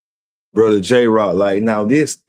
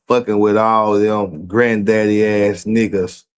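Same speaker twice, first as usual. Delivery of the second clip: ordinary talk, nothing marked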